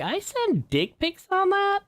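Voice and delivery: high, slow mock disbelief; mock incredulous. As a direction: squeak it out in fake innocence. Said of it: "I was like".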